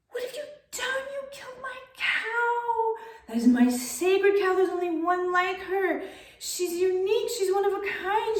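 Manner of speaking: stunned, high-pitched outrage at the master; horrified disbelief. Acted. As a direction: cry out in disbelief at the betrayal. Master slashed his sacred cow.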